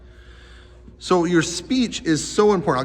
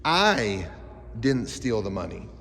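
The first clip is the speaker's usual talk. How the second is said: "I" stretched and set apart, then a pause, rest drops low; stress on I; 5 semitones lower, slower than usual. Shown as a demonstration. Teacher showing kids voice inflection, stress on I. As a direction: lean on "I", then let "didn't steal the money" fall away. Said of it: "Voice inflection is like this".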